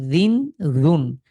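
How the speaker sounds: রিন, রুন stretched very slowly with clear n endings; teacher drilling রিন রুন slowly; slow drilled syllables. Shown as a demonstration. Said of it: "আমরা বলতে পারি run".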